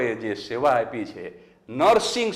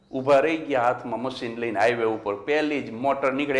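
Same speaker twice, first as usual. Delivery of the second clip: ordinary talk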